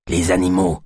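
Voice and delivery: cri d'alerte haut perché; alerte trop forte. A narrator's reading. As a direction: lance l'alerte sans retenue, aigu. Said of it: "Pas si fort". (alerte excitée, trop fort)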